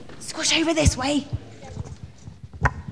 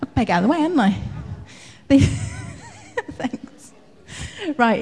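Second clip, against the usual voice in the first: usual speaking voice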